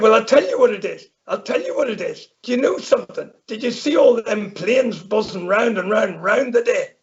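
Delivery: hammed-up Irish farmer grumbling about planes. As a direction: rattle on like an indignant Irish farmer. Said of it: "we can speak in an Irish accent".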